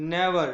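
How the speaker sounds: never with a loud, weighted "nev"; stress on first syllable. Shown as a demonstration. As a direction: punch the opening of never. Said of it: "first यहाँ आपको जो stress दिया जा रहा है".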